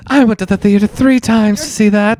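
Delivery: put-on high old-timer voice again; old moviegoer reminiscing; very old man. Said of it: "the old man voice time immediately".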